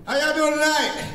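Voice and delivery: high, loud crowd greeting; calling out to crowd. Real, not acted. Comic greeting the club audience, loud call-out. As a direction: shout it to the back row.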